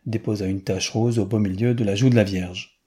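ordinary delivery

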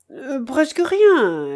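hesitate, then stretch PRESQUE RIEN; réponse hésitante. A narrator's reading. Hesitant answer breaking bad news. (« presque rien » qui traîne, hésitant)

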